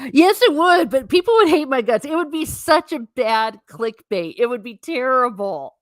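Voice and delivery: high, loud, tumbling over the words; excited protest. Real, not acted. Streamer to chat, excitedly protesting the clickbait idea. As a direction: throw it out high and loud, half laughing at the idea.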